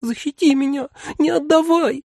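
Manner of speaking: squeaky terrified creature pleading; thin childish voice. A narrator's reading. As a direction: beg like a terrified tiny creature. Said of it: "умолял тоненьким детским голоском".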